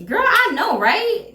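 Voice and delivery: high, sassy self-congratulation; hammed-up "I know it" attitude; mock conceited agreement. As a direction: play the vain friend soaking up praise. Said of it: "if you respond like".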